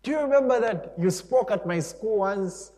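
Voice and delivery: high, loud, eager voice; eagerly jog their memory, high and loud; excited reminding. Acted. Stranger in heaven recalling a school visit, excited high voice.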